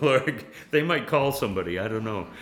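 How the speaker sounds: loud, fast laughing joke; laughing aside. Real, not acted. Preacher joking to folks about dancing in underwear, laughing. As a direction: chuckle at the image.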